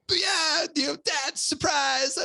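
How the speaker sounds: put-on very high, childlike squeal of surprise; little kid voice. Five-year-old son springing a surprise on his dad, high childlike squeal. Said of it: "I was like".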